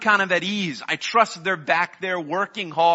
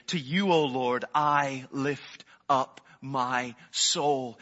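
reverent prayer; 2 semitones lower, much slower than usual. Acted. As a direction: savour each word to God. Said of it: "look to the Lord and say".

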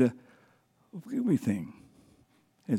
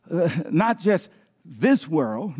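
usual speaking voice

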